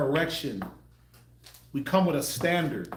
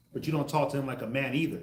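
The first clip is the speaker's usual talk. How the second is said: ordinary delivery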